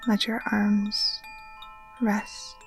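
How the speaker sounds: ordinary talk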